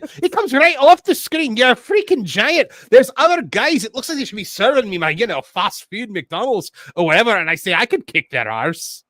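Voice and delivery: high, loud, hammed-up Scottish brogue; Scottish accent. Host rereading a viewer's quote, in an exaggerated Scottish accent. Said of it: "let's hear your Scottish accent".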